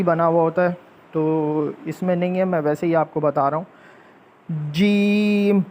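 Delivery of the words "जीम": जीम held with a bounce on the m; double-sound jeem. Shown as a demonstration. Teacher demonstrating जीम to children, held with an echo. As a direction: ring out जीम. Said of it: "double आवाज़ आ रही है".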